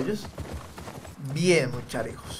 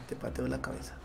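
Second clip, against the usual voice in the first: muttered; 2 semitones lower, 14 dB quieter than usual. Real, not acted. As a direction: mutter it under your breath. Muttering while kicking enemy. (murmullo amenazante)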